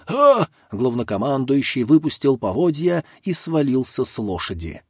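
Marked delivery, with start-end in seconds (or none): 0.1-0.5 sharp high shocked scream; a sudden cry of horror; loud scream. A narrator's reading. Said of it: "С громким криком".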